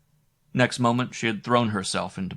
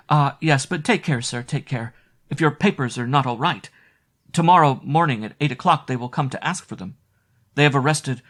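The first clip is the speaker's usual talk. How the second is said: put-on urgent young maid; 5 semitones higher, 4 dB louder than usual. Maid whispering warning of murders.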